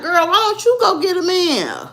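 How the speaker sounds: high-pitched mock exasperation; exasperated comeback. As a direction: throw it back at the pushy friend. Acted comeback to pushy matchmaker.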